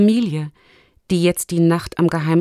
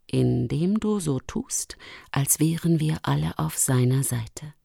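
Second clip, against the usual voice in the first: conspiratorial Luchsin; 4 semitones lower, 6 dB quieter than usual. Acted. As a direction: explain the secret plan low and softly. (leise, tiefe, verschwörerische Luchsstimme)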